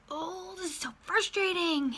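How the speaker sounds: high, drawn-out exasperated whine; frustrated groan. Retold or imitated. Stuck in traffic, she voices her frustration, exasperated whine. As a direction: moan it like you're stuck in traffic.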